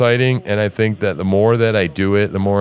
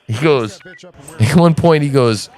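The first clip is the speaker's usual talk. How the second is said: normal talking voice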